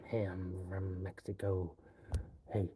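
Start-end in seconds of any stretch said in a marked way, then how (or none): none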